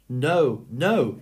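cried out. A narrator's reading. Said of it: "she cried".

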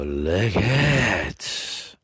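very slow, drawn out lick it; slow drawn taunt. Acted. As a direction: stretch out so lick it. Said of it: "drawn-out taunt".

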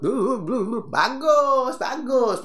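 playful babble demo; mock babble. Shown as a demonstration. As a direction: imitate a fast talker tumbling over words. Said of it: "pingin cepet-cepet".

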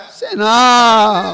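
long, loud drawn-out denial; shouted protest. Real, not acted. Pastor denying he hugged the pole, drawn-out shout.

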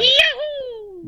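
very high cartoon Mario yahoo; Mario impression. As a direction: whoop it like Mario jumping. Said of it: "That's a Mario impression".

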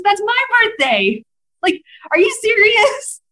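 high, thrilled amazement; retelling the shared birthday shock, excited; excited disbelief. Retold or imitated. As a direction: blurt it out in delight. Retold excited discovery.

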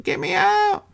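very high squealed plea; mock panicked cry. Woman stuck in the elevator, mock panicked. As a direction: squeak it like someone trapped, hammed-up.

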